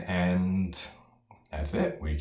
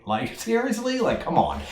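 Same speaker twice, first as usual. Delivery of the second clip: high, squeaky disbelief; incredulous; 13 semitones higher than usual. Real, not acted. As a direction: shoot the pitch up in disbelief. Disbelief at the cow joke.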